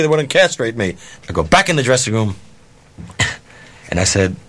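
ordinary talk

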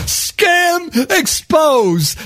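drawn-out slow proclamation; slow announcement. Acted. Narrator proclaiming the scam exposed, slowly. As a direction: announce it like a headline.

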